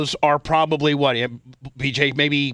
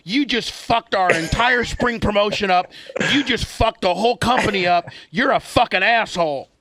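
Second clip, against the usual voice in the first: furious boss; 6 semitones higher than usual. Retold or imitated. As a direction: lay into him, nose to nose. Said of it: "when you're really fucking make B J mad".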